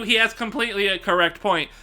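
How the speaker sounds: ordinary talk, nothing marked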